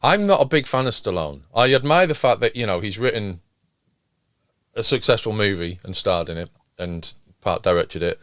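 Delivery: usual speaking voice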